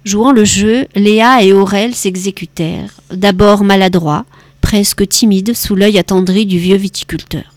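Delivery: usual speaking voice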